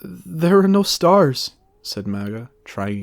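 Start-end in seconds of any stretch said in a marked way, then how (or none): 0.0-1.5 higher, louder, slow; theatrical cry. A narrator's reading. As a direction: keep stalling with a flourish. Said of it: "speaking quieter".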